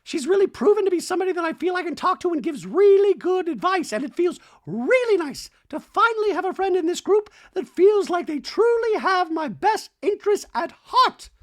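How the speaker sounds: hammed-up Dorit confessional; mock Dorit. Host voicing Dorit's talking head, high. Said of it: "Dorit in a Talking Head says".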